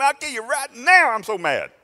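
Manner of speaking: high, loud, lost-it rage; furious prophet. Acted. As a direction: yell it like he's lost it. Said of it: "Man, he lost it".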